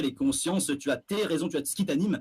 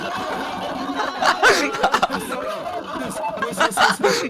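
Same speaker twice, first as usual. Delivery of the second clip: loud, high, breathy burst of laughter; 13 semitones higher, 6 dB louder than usual. Real, not acted. Debater cracking up at an angry retort, loud laughter. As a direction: crack up loudly and at length.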